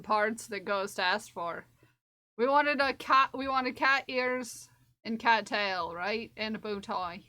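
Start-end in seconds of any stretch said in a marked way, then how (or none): none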